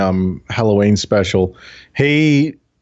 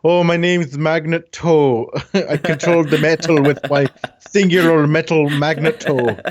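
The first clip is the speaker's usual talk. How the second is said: slow Fassbender-as-Magneto voice, pitched up; Magneto impression; 4 semitones higher than usual. Retelling a YouTuber's joke about X-Men, as Magneto. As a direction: drawl it out like a hammy Magneto. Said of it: "as if he was Magneto".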